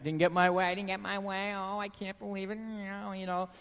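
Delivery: quiet, mumbling sulky self-pity; mock cranky sulk. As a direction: mutter like a grumpy man who lost out. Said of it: "do you ever get cranky".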